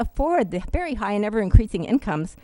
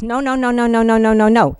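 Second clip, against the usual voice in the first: emphatic repeated refusal; 7 dB louder than usual. Retold or imitated. Speaker mimics callers being turned down time after time, insistent refusal. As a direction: run the no's together, swelling louder.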